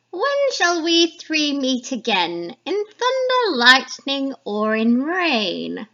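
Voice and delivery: high, loud, slow witch's cry; loud eerie hag voice, drawn out; first witch. Acted. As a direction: call out like an old crone summoning a storm. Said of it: "introduce you to the three witches".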